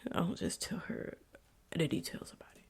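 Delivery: a soft, voiceless whisper; whispered admission. Real, not acted. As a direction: confide it under your breath. Admitting she hides details.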